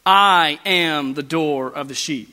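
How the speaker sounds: slow, weighty "I am", each word given room; emphatic. Shown as a demonstration. Preacher quoting Jesus to catch their attention, emphatic "I am". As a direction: stretch out "I am" and declare it firmly. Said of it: "It's emphatic".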